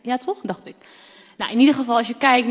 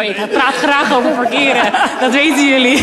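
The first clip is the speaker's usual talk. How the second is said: laughing; 4 semitones higher, 8 dB louder than usual. Real, not acted. Joking she loves parking talk.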